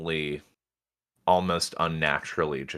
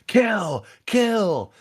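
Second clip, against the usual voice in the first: high-pitched, drawn-out scream of a command; a high yell, a little louder, held back from full force; mock scream; 5 dB louder than usual. Bitch screaming as Leviathan attacks her dogs, high mock scream. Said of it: "Bitch screams".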